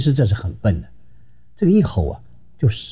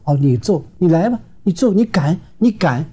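high, taunting dare, slowing at the end; defiant dare; 8 semitones higher than usual. Retold or imitated. Wife daring her husband to hit her, high taunting voice. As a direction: goad him to hit her. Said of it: "做妻子就不服了".

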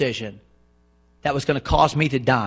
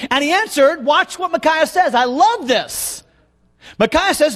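impassioned preaching; 8 semitones higher, 3 dB louder than usual. Real, not acted. Preacher charging young Garrett to speak God's word, impassioned preaching. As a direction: preach it with rising urgency.

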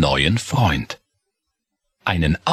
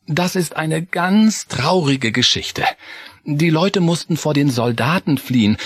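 higher, gently sorrowful mother voice; mother mouse, touched by the story; sad mother mouse; 6 semitones higher than usual. Acted. Frau Kirchenmaus voiced.

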